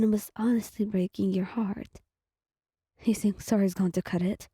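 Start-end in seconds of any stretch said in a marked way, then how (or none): none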